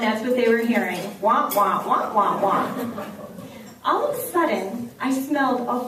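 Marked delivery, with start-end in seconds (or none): 1.2-2.7 muffled trombone wah-wah talk; Charlie Brown teacher wah. Retold or imitated. Teacher lecture as the kids hear it, a muffled wah-wah. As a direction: mimic the droning adult voice from Charlie Brown. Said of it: "just like Charlie Brown".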